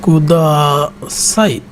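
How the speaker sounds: কুদাসাই stretched out slowly, each syllable ku-da-sa-i drawn apart. Shown as a demonstration. Teacher to students, slowly spelling out কুদাসাই syllable by syllable. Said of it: "sentence শিখি".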